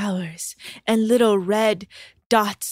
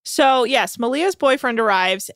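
usual speaking voice

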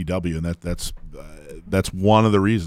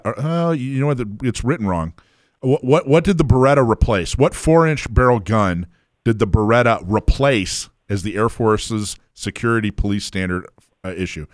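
normal talking voice

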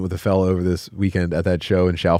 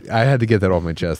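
ordinary talk, nothing marked

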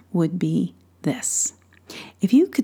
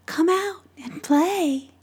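fairy voice; 8 semitones higher, slower than usual. Acted. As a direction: call sweetly like tiny fairies. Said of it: "snowflake fairies' voices could be heard calling".

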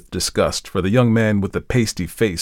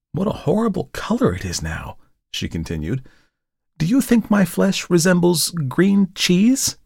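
put-on teasing young woman; 10 semitones higher than usual. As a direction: poke fun at the painter. Model teasing the artist.

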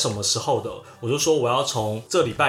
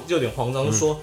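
ordinary talk, nothing marked

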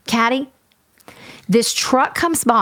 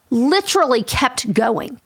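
normal talking voice

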